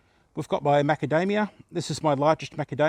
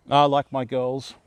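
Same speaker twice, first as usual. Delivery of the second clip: usual speaking voice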